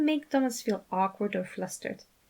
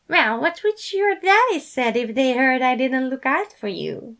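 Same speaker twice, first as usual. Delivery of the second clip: Remus voice; 5 semitones higher, 6 dB louder than usual. A narrator's reading.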